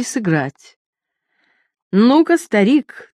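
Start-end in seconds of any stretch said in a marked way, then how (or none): 1.9-3.1 slow, loud fairground hawker call; loud carnival barker. A narrator's reading. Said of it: "тоном ярмарочного зазывала воскликнул".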